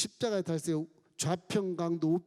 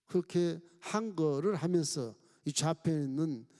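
ordinary talk, nothing marked

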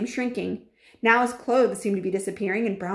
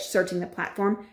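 ordinary delivery

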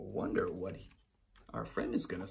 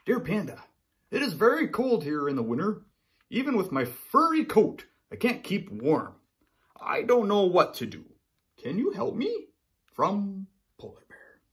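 higher, louder reading of the polar bear's letter; lonely polar bear reading his letter; 7 semitones higher, 6 dB louder than usual. Acted.

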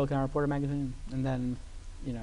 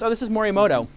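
higher, delighted offer, a laugh in it; mock Barry; 7 semitones higher, 7 dB louder than usual. Barry proudly offering sushi upstairs, high and delighted. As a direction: play the host showing off his sushi bar.

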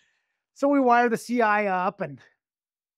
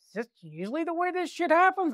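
incredulous investigator; 10 semitones higher than usual. Retold or imitated. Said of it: "and he goes".

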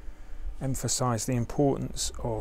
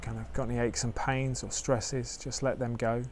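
guided meditation; 4 dB quieter than usual. Acted. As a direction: ease listeners into stillness gently.

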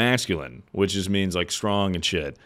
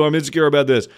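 normal talking voice

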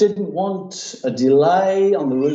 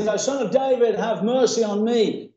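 raised, insistent prayer cry; 10 semitones higher than usual. Acted. Beggar praying over the crowd, crying out. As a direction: call out the plea at a higher pitch. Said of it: "he cried the more a great deal".

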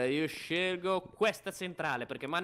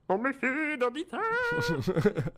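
high, slow, drawn-out silly voice; squeaky mock distrust, every word dragged; silly put-on voice; 10 semitones higher, 4 dB louder, much slower than usual. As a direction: stretch it out high in a cartoonish voice. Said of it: "Perché hai fatto questa voce così?".

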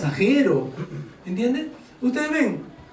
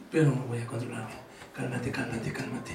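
much softer, lower, soothing yourself; low, soft repeated calm-down; calming oneself; 10 semitones lower, 10 dB quieter than usual. Acted. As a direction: murmur it to calm your own temper. Talking oneself down from anger.

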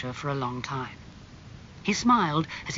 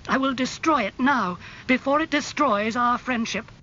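angry threat; 9 semitones higher, 5 dB louder than usual. A narrator's reading. Painter threatens to destroy portrait.

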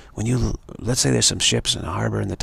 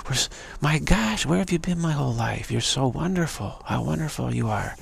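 higher, swooning voice easing down as it goes; gushing female admirer; 4 semitones higher than usual. Acted. Beautiful female flattering her target, gushing. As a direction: put on a gushing, love-struck greeting. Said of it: "beautiful female show up and say".

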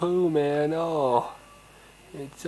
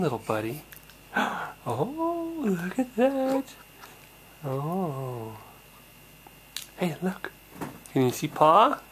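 put-on high, sing-song cooing to a baby; talking to a baby in a high sing-song voice; 6 semitones higher than usual. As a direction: coo up high at the little one.

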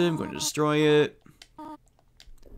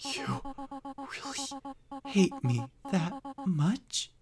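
soft, slow, heartbroken; a mother's last faint words; hushed dying Toriel; 13 semitones higher, 7 dB quieter, much slower than usual. Acted. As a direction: let the words fade out in hurt. Reads dying Toriel's lines.

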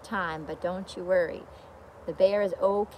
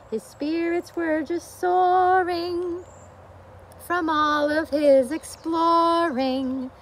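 lively storybook reading; reading to children; 8 semitones higher, much slower than usual. A narrator's reading. Teacher reads the bear book to children, storybook voice. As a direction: read it out for a room of little ones.

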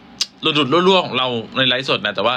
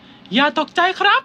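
much higher cartoon robot-cat voice; Doraemon voice. Doraemon giving the cinema fire-alarm notice, high. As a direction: announce it as Doraemon. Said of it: "โดราเอมอนพูดนะ".

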